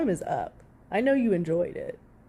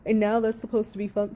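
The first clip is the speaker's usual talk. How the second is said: ordinary talk, nothing marked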